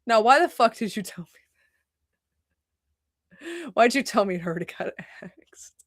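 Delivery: trailing into a whispered complaint; fed up, drop to a whisper; exasperated whisper. Retold or imitated. Telling the viewers her reaction, a fading whisper. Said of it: "And I'm like".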